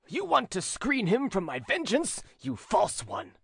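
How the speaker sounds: the duke accuses Angelique; enraged accusation. A narrator's reading. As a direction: accuse her of shielding him.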